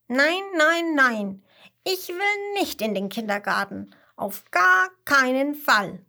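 put-on high, loud child's refusal; emphatic no, leaping up in pitch and volume; defiant little koala. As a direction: dig in like a stubborn youngster.

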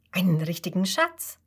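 ordinary delivery, nothing marked

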